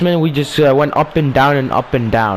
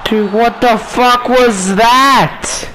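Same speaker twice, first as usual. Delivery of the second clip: exasperated; 6 semitones higher, much slower than usual. Real, not acted. Frustrated at the match.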